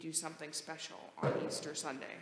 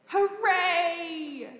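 high, loud cheer; excited hooray; 10 semitones higher, 8 dB louder than usual. Shown as a demonstration. Pastor cheering with the children, high and loud. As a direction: throw up a happy hooray. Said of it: "things you say when you're excited".

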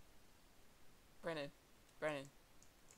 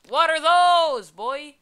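mock challenge; 7 semitones higher, 11 dB louder, slower than usual.

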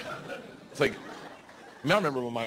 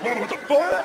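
high-pitched startled cry; shocked outburst; 10 semitones higher, 3 dB louder, slower than usual. Acted. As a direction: blurt it high in mock shock. Acting shocked reaction in bed.

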